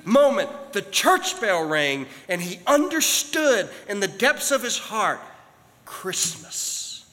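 loud, high, stretched-out preaching; preaching at full force. Acted. Preacher building to the climax for the church, full force. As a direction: drive the message home to the congregation.

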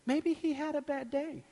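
high, soft, sweet wife voice; hushed, high-pitched put-on voice; Ronnie. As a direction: imitate a gentle wife making excuses for someone. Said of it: "this is my Ronnie impersonation".

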